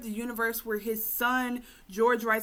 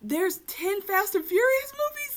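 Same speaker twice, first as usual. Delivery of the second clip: higher and slow, hammed-up astonishment; mock disbelief; 13 semitones higher, 6 dB louder than usual. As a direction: stretch it out in fake shock. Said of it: "he's like".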